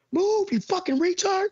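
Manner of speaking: mock yell. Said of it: "I constantly yell at drivers".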